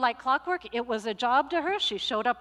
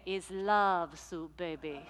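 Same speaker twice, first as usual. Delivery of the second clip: laughing softly; 5 semitones lower, 6 dB quieter, slower than usual. Retold or imitated. Luisa answers about her soup.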